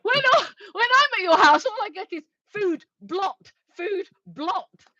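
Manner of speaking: high, loud comic grievance; mock complaint. Real, not acted. Streamer griping about visits to co-host's house, mock complaint. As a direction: protest loudly at a high pitch.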